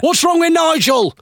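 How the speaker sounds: very high, drawn-out mock heckle; imitated heckler. Heckler challenges the comedian about Nigel, high mock shout. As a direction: squawk it like a pub heckler, stretched out. Mimics heckler from played clip.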